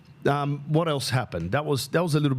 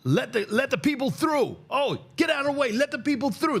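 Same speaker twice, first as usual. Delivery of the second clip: high, strained shout with a put-on street voice; imitating a jank yelling orders; mock junkie yell. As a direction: bellow at the crowd to clear a path, pitched way up. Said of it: "one of the janks just yells out".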